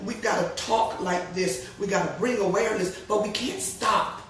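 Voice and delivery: ordinary delivery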